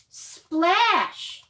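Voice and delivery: loud, high splash sound. Acted. Librarian voicing the turtle diving, loud splash. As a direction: splash it out big.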